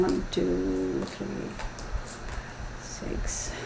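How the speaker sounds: very soft, barely voiced count; counting to oneself. Real, not acted. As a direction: count the cards under your breath. Counting cards to herself.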